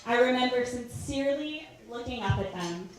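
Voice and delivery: normal talking voice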